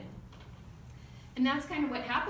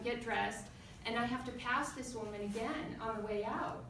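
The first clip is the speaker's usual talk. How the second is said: ordinary delivery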